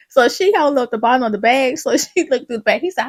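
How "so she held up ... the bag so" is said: ordinary delivery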